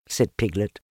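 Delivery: ordinary delivery, nothing marked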